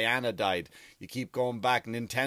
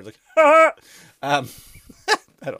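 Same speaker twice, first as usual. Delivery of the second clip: bursting into a laugh; 7 semitones higher, 12 dB louder than usual. Real, not acted. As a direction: crack up mid-sentence. Laughs at own impression.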